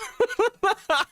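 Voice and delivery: high burst of laughter; high cackle. Real, not acted. Streamer laughing at own joke, high cackle.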